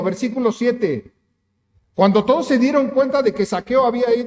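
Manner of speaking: normal talking voice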